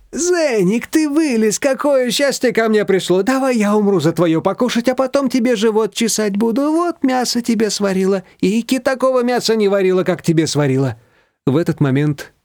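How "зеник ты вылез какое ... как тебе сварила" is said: high-pitched pet-talk, cooing and effusive; doting gushing. A narrator's reading. Shushana coaxing her dog out with meat, doting. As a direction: fuss over a beloved dog in a high voice.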